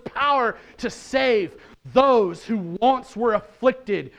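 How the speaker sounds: high, loud, rousing proclamation; full-force preaching. Real, not acted. Preacher proclaiming the empty tomb's power to believers, full-force preaching. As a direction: drive each phrase home with fervour.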